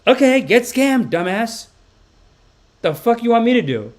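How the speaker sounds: mock telling the aunt off; dismissive retort. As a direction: scoff at the aunt. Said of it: "It's like".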